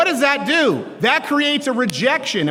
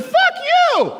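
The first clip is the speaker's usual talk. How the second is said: sudden high yelled curse; mock outraged kid yelling; kid's shout. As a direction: blurt it out like a furious eleven-year-old. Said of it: "And I said".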